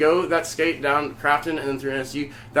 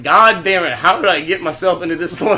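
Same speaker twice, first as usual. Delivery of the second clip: loud, exasperated self-reproach with amusement; 2 semitones higher, 6 dB louder than usual. Retold or imitated. Man stuck walking three miles home, exasperated. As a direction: lament it loudly, half laughing. Acting out exasperation at his predicament.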